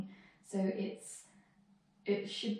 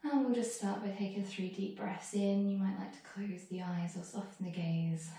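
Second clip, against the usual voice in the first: ordinary delivery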